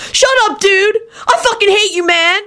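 high-pitched, whiny fury; angry outburst. A narrator's reading. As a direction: protest in an outraged high voice. Brothers quarrel.

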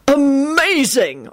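high, drawn-out amazed cry; excited shout. A narrator's reading. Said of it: "Arthur shouted".

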